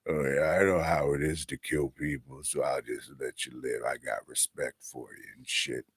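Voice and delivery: very low, soft tough-guy voice; Rambo. Acted. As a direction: drop deep and quiet, mock stoic hero. Voices Rambo being nice to neighbours.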